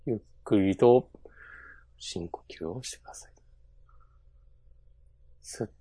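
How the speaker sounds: slow whispered meditation voice; guided meditation. Pretend. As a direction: breathe them in and out, hushed. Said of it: "それ絶対スピリチュアルなやつでしょ".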